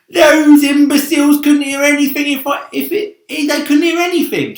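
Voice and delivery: loud, high, mocking centipede voice; scornful cry. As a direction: crow it out, full of bravado. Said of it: "he cried".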